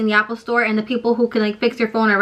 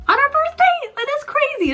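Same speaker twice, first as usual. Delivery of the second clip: squeaky, much higher disbelief; incredulous squeal; 14 semitones higher than usual. Real, not acted. Telling viewers about meeting his family, high incredulous squeal. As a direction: shoot way up in pitch at the absurdity.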